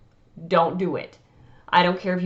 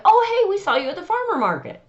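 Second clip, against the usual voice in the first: put-on excited kid greeting; 11 semitones higher, faster than usual. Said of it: "be like".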